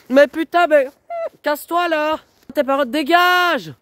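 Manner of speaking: high, loud screaming fit at her mother; explode, scream her away; furious shouting. Real, not acted. Teen screams at her mother to get out, furious.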